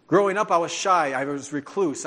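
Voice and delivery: ordinary talk, nothing marked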